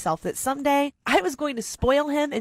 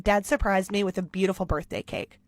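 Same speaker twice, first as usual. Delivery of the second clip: ordinary delivery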